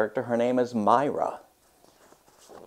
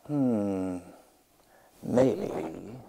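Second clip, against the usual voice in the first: musing; 7 dB quieter than usual. Acted. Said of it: "thought to herself".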